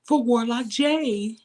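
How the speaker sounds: mocking the cast's sudden vigil plans; mocking gushing voice. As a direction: lightly send up the fake concern. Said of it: "That turned into".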